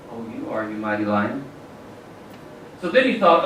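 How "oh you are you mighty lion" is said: tiger answers much lower and much softer, meekly; bow your head and concede quietly. A narrator's reading. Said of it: "bowed his head and said".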